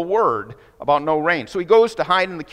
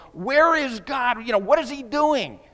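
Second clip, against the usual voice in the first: distressed believer; 9 semitones higher than usual. Retold or imitated. Hurting believer in the ravine, pleading in a higher voice. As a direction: ask it like someone lost in pain. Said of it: "and you're going".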